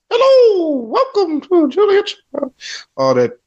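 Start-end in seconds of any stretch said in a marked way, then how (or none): 0.1-2.2 extremely high-pitched TV cook welcome; Julia Child impression. Co-host mimics the TV cook he grew up hearing, high-pitched. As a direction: sing out the greeting way up high. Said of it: "hearing that high-pitched voice".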